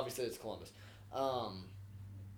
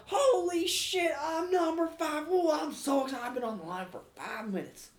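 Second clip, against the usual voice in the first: mock excited caller; 12 semitones higher than usual. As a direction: squeal it like a giddy fan. Prank caller voiced high, excited.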